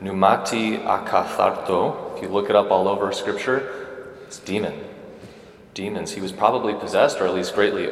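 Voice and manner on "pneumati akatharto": "pneumati akatharto" said slowly in careful Greek, each syllable spelled out; Greek term. Shown as a demonstration. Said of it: "I'll try to say this in Greek".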